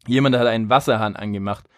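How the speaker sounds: ordinary talk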